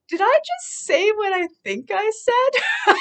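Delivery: high and loud, laughing through the question; laughing disbelief. Real, not acted. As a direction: giggle at your own outburst. Stunned by own outburst.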